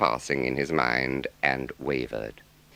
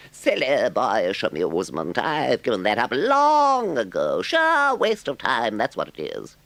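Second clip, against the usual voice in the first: very high, loud, eager Toad; a boastful toad begging for help; Toad's voice; 9 semitones higher, 6 dB louder than usual. A narrator's reading. Toad scorning boating.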